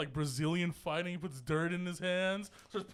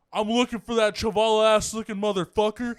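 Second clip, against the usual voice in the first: Nate Diaz trash talk; 2 semitones higher, 8 dB louder than usual. Retold or imitated.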